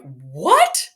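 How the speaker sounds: loud, incredulous rising question; acted-out stunned reaction; retold disbelief. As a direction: shoot the word upward in disbelief. Retold shocked reaction to the command.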